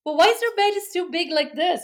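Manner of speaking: lightly voiced young son puzzling over mom's belly; curious little boy. Son's question voiced in story.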